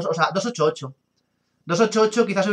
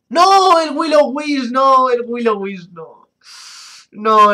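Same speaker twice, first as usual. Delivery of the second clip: anguished shout; 9 semitones higher, 8 dB louder, much slower than usual. Real, not acted. Outraged reaction to a Will-O-Wisp.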